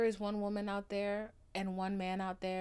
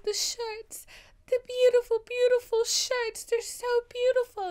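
much higher and louder, swooning over the shirts; Daisy gushing; 11 dB louder than usual. Retold or imitated. Daisy weeping over Jay's shirts, high and swooning. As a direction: gasp out the praise like she's overwhelmed. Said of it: "she's like".

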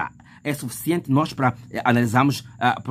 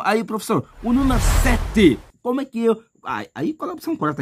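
asking student; 6 semitones higher than usual. Pretend. Student calling the teacher about question seven, higher. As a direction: call out to the teacher for help.

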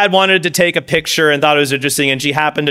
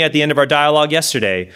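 normal talking voice

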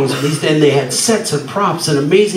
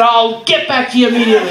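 giddy promise; 6 semitones higher than usual. Retold or imitated. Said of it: "I said".

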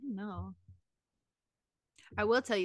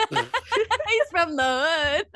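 slow, drawn-out words through laughter; laughing; 4 semitones higher, 4 dB louder, much slower than usual. Real, not acted. Joking about a dog's thug accent, laughing and drawn out. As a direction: crack up while stretching the words out, higher.

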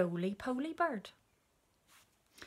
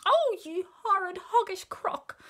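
high, loud monkey outrage; outraged cry; 12 semitones higher, 8 dB louder than usual. A narrator's reading. Monkey berates the child-eating crocodile, high loud cry. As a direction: shriek the insult, stretching it out. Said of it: "cried Mugglewump".

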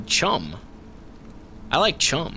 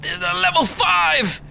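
sudden high, exclaimed protest; high protesting yell; 9 semitones higher than usual. Real, not acted. Gamer protesting it is level five, high yell. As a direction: burst out high in disbelief.

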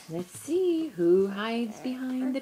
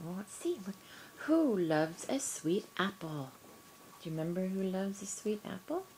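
normal talking voice

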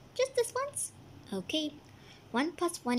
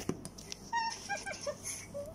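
gleeful laugh; 10 dB quieter than usual. Acted. Pranksters laugh after attack.

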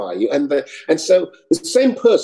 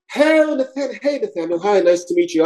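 high-pitched breezy greeting; casting woman; 6 semitones higher than usual. Retold or imitated. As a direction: greet the auditioner, starting high. Imitates casting woman greeting him.

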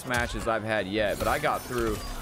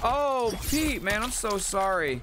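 sympathetic; 10 semitones higher, slower than usual. Real, not acted. Sympathy to viewer Pete.

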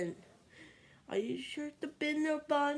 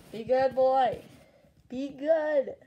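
loud scolding; 11 dB louder, slower than usual. Real, not acted. Loudly scolding.

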